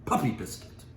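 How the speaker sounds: loud, high, scornful outburst; shouting insult. Acted. Mitty in court shouting at the attorney, loud and scornful.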